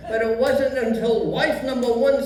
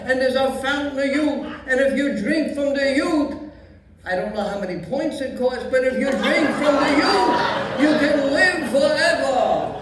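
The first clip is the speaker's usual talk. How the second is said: put-on old Yiddish grandpa accent; Yiddish old man voice.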